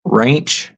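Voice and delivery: ranch said as a drawn-out RAINCH; dialect pronunciation. Shown as a demonstration. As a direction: say ranch like "raynch". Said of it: "I guess that's how you say that".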